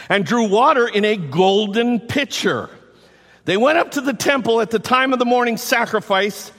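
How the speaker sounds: preacherly quotation; read-aloud quote. Acted. Reads commentary quote with lift.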